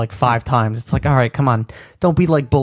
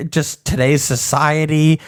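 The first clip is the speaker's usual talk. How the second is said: ordinary talk, nothing marked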